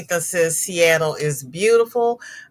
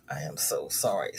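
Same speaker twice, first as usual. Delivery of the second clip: a breath-only, much softer apology; whispered sorry; 10 dB quieter than usual. Real, not acted. Consoling friend after fire.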